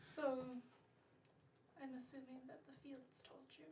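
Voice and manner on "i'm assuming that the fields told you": whispered suspicion. Real, not acted. Bard whispering a guess to the fae lord. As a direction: breathe it out, barely voiced.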